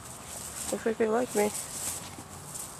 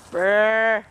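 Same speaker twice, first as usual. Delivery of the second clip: barking; 6 dB louder than usual. Pretend. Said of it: "I was barking".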